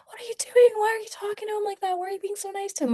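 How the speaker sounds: panicking, demand it in a shrill rush; frantic panic. Retold or imitated.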